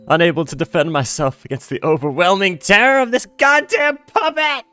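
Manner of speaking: high, loud hammed-up panic; mock terrified scream. Mock helpless scream at puppet's terror.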